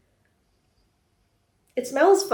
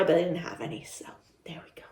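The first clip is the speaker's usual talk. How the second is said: whisper; 5 semitones lower, 9 dB quieter, faster than usual. Real, not acted. Aside about missing vegan burger.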